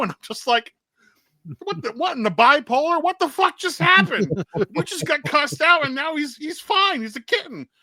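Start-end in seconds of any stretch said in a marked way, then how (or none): 1.6-7.7 very high, incredulous retold reaction; mock-stunned, pitched way up; baffled disbelief. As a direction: act out total bewilderment at a mood swing. Acting out baffled reaction to mood swing.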